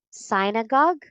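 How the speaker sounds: synagogue as SIGH-nuh-gog; wrong first vowel. Shown as a demonstration. Teacher offers possible readings, wrong first vowel. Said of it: "How do you read this word?".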